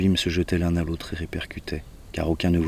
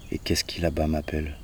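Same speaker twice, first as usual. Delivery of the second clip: ordinary delivery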